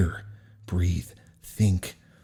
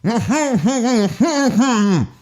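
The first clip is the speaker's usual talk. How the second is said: muffled scream; 4 dB louder than usual. Shown as a demonstration. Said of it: "the way it would actually come across".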